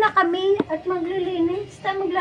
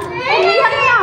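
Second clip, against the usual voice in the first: loud teasing call; gleeful teasing; 6 semitones higher, 4 dB louder, slower than usual. Real, not acted. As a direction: crow it out in delight.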